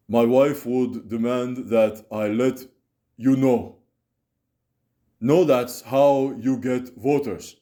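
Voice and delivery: a lightly Russian-sounding Bernie Sanders; Bernie impression with Russian tinge. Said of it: "I sounded a little Russian there".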